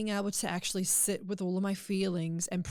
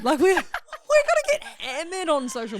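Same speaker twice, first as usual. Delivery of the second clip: laughing; 10 semitones higher, 10 dB louder than usual. Real, not acted.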